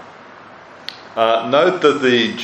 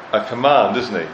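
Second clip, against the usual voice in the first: normal talking voice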